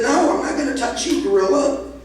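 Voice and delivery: quick, high-pitched refusal; acted protest. Guy refuses the goading gorilla.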